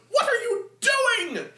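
high, slow, loud yell; outcry. Acted. Minsk bursts out at the deacon, loud high outcry. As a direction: bellow it as a warrior outcry.

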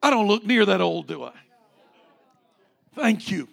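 ordinary talk, nothing marked

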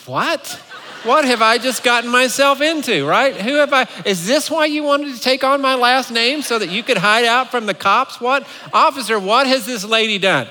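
hammed-up rant of a stunned husband; mock shock. Acted shock at wife's warrant.

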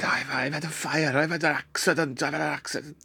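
put-on high fluttery old lady; flustered and breathless, a panicky aunt; Nine. Aunt reporting the kitchen fire, high and flustered. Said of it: "And she said".

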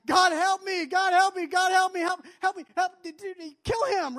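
high, loud frantic begging; desperate pleading. Retold or imitated. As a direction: cry out to God in a mock panic. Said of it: "Life is bad".

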